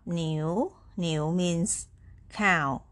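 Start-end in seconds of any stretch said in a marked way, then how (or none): 0.0-0.7 "niu" with the pitch going up; second tone. Shown as a demonstration. Teacher showing learners the word for cow, rising second tone. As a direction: let "niu" rise to its end. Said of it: "going up".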